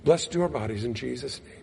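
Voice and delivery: a hurried throwaway blessing; mock rote prayer. Mocking a quick rote grace before a meal. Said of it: "it wasn't".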